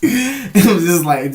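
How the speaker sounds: laughing, high and loud. Real, not acted. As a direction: laugh through it. Laughing while telling.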